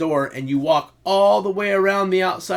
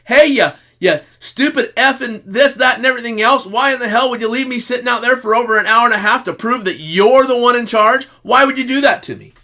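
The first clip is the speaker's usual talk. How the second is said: louder, higher, heated rant; imagined angry rant; 7 semitones higher, 6 dB louder than usual. Retold or imitated. Husband imagines cursing out his wife for leaving him, angry rant. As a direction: vent everything he held back. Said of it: "I didn't say".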